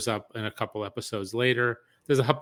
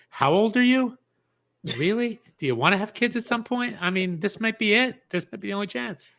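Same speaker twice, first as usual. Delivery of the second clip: higher, worried questioning tone; a woman fretting to herself, pitched up; anxious inner voice; 9 semitones higher than usual. Retold or imitated. Friend voicing the heroine's doubts, higher and anxious. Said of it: "It's like".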